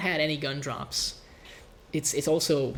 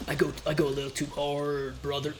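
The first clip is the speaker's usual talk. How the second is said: silly put-on bro voice; goofy put-on bro voice; funny voice. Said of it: "I don't know what that was supposed to be".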